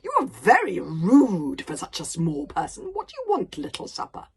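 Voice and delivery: a giant scolding a rude little thing; exasperated dragon. A narrator's reading. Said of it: "He sounded a bit exasperated now".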